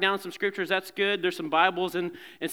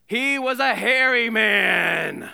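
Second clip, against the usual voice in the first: loud, higher, drawn-out dramatic proclamation; hammed-up hairy man; 5 semitones higher, 6 dB louder, slower than usual. Shown as a demonstration. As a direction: belt it out slowly, hamming it up. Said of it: "You gotta say it like".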